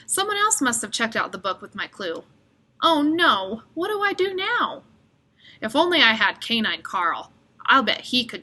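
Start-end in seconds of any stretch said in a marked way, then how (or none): 2.8-4.8 higher, rising worried cry; dismay. Acted. Boy finding no clue in the books, worried. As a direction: panic a little.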